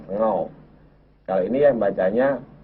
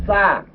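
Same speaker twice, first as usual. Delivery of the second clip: high, loud model syllable to repeat; over enunciating; 10 semitones higher, 4 dB louder than usual. Shown as a demonstration. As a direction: call it out clearly for the class. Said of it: "Tirukan saya dulu, pelan-pelan".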